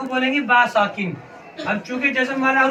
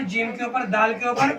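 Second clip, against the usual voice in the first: ordinary talk, nothing marked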